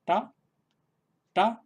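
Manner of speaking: "ta" high and loud, tongue tip curled back; retroflex ta. Shown as a demonstration. Teacher drilling the first retroflex sound for students. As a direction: punch out a retroflex "ta". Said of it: "hemos dicho el primero, que es".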